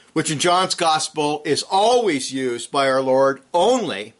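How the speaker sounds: ordinary talk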